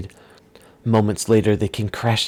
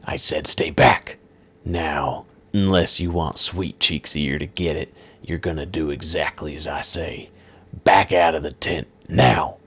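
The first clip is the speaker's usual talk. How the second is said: menacing villain; 3 semitones lower than usual. Acted. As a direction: lean on the threat like a thug. Villain threatens the heroes.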